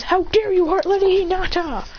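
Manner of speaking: high, indignant retold accusation; outraged challenge. Clansman attacking over Lady Hinata, high and outraged. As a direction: confront him with pitch shot up.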